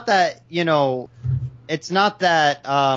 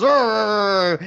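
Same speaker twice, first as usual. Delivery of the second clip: mock growl; 6 semitones higher, 3 dB louder than usual. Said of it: "comes out of nowhere and goes".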